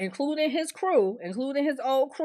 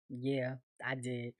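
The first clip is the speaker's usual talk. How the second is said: much lower and softer, slowed; mock quiet admission; 12 semitones lower, 14 dB quieter, slower than usual. [name] admitting it to Tiffany, low and quiet. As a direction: mumble it low like a guilty confession.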